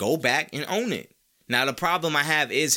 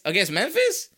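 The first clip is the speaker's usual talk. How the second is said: ordinary talk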